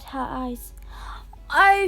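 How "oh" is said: sob. A narrator's reading. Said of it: "she sobbed".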